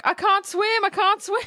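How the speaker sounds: high, frantic plea of a drowning man; panicked cry. Retold or imitated. Podcaster mimics a panicking giant who can't swim, high and frantic. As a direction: squeal it out like he can't stay afloat. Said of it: "he's like".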